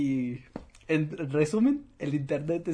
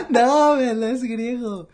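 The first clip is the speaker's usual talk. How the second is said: loud high laughter; 8 semitones higher, 10 dB louder than usual. Real, not acted. Laughing hard.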